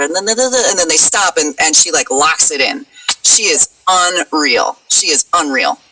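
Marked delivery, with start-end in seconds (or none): none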